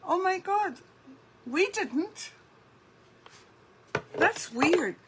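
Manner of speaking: high-pitched surprised exclamation; genuinely taken aback, voice jumps up. Real, not acted. Viewer had rain, she didn't.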